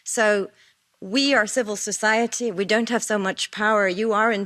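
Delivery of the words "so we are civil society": ordinary talk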